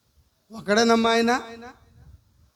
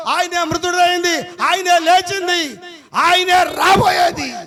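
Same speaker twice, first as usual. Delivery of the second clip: building sermon litany; 4 semitones higher than usual. Acted. Preaching at full force.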